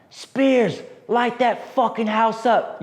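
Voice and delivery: high, slow shout across the valley; sergeant's yelled order. Retold or imitated. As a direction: call the order out like a sergeant under fire.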